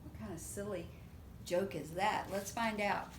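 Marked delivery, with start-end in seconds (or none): none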